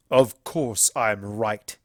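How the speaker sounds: slow, scornful frown. A narrator's reading. Stallion scorning Twilight, frowning. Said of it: "he frowned".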